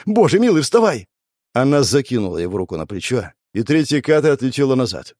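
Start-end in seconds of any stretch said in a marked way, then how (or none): 0.1-1.1 panicked plea. Acted. Girl begs fallen lover.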